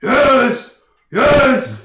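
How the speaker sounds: very loud, high delighted bellow; ogre roar. Acted. Game master as ogre welcoming a fight, roaring. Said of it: "The ogre goes".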